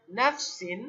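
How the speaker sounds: usual speaking voice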